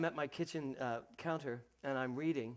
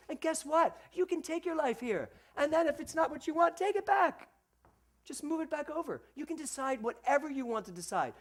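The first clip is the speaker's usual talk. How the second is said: high, loud rousing preacher call; rally the congregation to take their lives back; full-force preaching; 12 semitones higher, 7 dB louder than usual. Acted.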